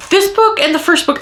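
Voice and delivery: ordinary talk, nothing marked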